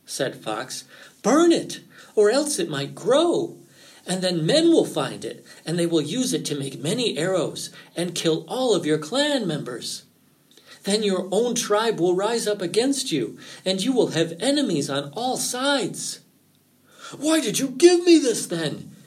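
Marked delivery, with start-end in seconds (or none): 1.2-16.2 high, loud opening, then urgent warning; dramatic, high-pitched prophecy of doom; alarmed fox. A narrator's reading. Fox's dire warning. 17.2-19.0 high, loud, alarmed question; anxious rabbit crying out in dismay. A narrator's reading. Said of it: "asked Rabbit anxiously".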